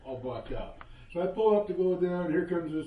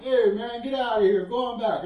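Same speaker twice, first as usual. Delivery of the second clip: louder, higher mocking jeers with a laugh; crowd taunting; 5 semitones higher, 5 dB louder than usual. Crowd in the street taunting the old man, mocking jeer. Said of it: "getting the crowd taunting".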